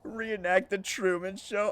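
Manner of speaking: high and loud, thrilled by the idea; excited outburst. Real, not acted. Streamer lights up at chat's Truman Show idea, excited and loud. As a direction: burst out with excitement.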